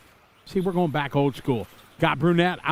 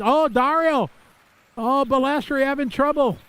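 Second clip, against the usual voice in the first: excited shout; 9 semitones higher than usual. Real, not acted. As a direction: react to the car tumbling, pitch jumps up. Crash on track during race.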